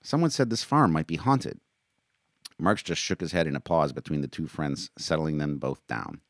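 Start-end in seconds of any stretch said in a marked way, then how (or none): 0.0-1.6 high, wondering tone; amazed. A narrator's reading. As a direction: carry on in raised-pitch wonder. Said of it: "Maori said, amazed".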